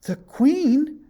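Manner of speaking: very high, loud, shocked gasp. A narrator's reading. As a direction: gasp it in disbelief.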